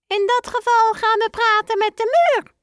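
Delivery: very high, squeaky little mouse voice; high mouse voice ending in a piep; squeaky father mouse. Acted.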